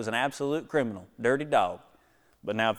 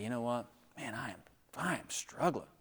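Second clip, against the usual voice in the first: a hushed, breathy confession; whispered confession; 11 dB quieter than usual. Acted. Churchgoer confessing struggle to the church, whispered.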